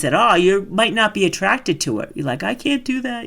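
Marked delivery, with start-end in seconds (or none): 2.4-3.3 high, mocking whine of a doubter; scornful high mock voice; disparaging. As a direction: sneer at the timid customer. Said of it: "I have disparagement in my voice".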